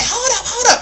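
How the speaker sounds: high, loud mock holler; hammed-up shrieked protest; rowdy. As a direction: imitate a riled woman calling a halt. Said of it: "getting just real rowdy about it".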